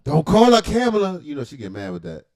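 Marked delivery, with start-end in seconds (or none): 0.1-1.2 sudden loud high call-out; loud warning. Real, not acted. Streamer warning the chat about her name, loud. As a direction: bark the warning at the viewer.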